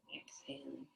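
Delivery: breathy whispered breathing cue; whispered exhale cue. Acted.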